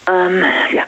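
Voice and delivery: fighting back tears; close to tears. Real, not acted. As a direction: voice catching, holding back a sob. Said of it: "anfange zu heulen".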